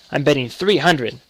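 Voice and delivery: usual speaking voice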